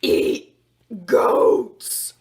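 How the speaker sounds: loud, rasping unvoiced monster scream; monster shriek. A narrator's reading. The monster shrieks its demand at the princess. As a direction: screech it like a hungry beast. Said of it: "the monster shrieked".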